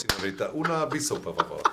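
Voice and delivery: put-on reciting Spanish phrases in a Spanish accent. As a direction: rattle off stock Spanish lines with an accent. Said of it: "You even say it with a Spanish accent".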